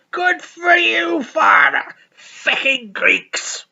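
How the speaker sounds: high slow drawn-out shout; high slow hammed-up yell; mock shout. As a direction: bellow it slowly like a hammed-up priest. Acted Father Ted dialogue.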